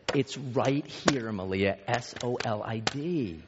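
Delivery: very slow, deliberate spelling-out; retold exasperated dad, drawn-out words; strained patience. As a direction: spell it out slowly, letter by letter. Exasperated second spelling for daughter.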